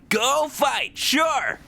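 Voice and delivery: high-pitched cheering shout; high robot cheer. Retold or imitated.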